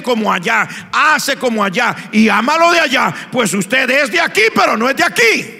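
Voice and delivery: loud, high, chanted sermon climax; fiery preaching. Acted. Preacher calls the congregation to the altar, loud chanted preaching. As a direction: hammer it at the congregation.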